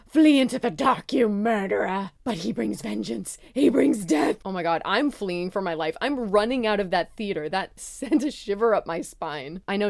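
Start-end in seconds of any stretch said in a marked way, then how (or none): 0.0-4.4 hammed-up prophetic wail; dramatic movie-line imitation. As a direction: declaim it like a doom-laden warning, loud at start and end. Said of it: "she goes".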